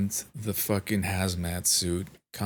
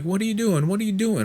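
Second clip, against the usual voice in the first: high, loud mock-alarmed yell; imitated yelling; 12 semitones higher, 5 dB louder, much faster than usual. Nurse catching a patient pulling off wires, mock yelling. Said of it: "she's yelling at me".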